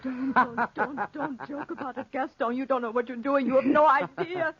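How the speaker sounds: rising to a high, crying shout; frightened sobbing plea building to a scream; panicked pleading. Acted. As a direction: beg him to stop in terror. Pleading, panicking at his joke.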